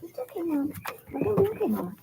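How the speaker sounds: high, small voice for the dog; dog's voice. Pretend. Owner voicing her dog answering Mom. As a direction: speak for the pet.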